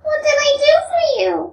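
high, quick, gentle-youth character voice; quick question in Alyosha's high register. Acted. Reads Alyosha's reply.

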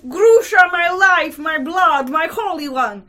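loud, high outpouring of devotion; impassioned cry. A narrator's reading. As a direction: cry out to your beloved, desperately. Lover's desperate outcry to Grushenka.

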